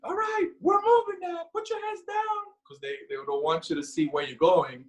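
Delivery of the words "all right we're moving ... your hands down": high barked order, imitated; hammed-up high command; mock drill order. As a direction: bark it like a drill sergeant. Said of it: "he's like".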